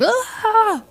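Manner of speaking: high-pitched fake sob; put-on crying outburst; mock crying wail. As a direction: burst into a hammed-up wail. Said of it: "you just have a little cry fest".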